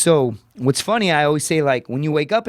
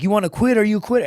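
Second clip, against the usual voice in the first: higher-pitched taunting challenge; mock instructor goading; imitated drill instructor; 7 semitones higher, faster than usual. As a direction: needle a recruit like an instructor. Voicing taunting Marine instructors.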